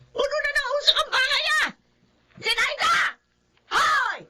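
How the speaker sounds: high, strained yell at the neighbour; angry yelling. Acted. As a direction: shout across the yard in anger. Neighbour yelling over smoke.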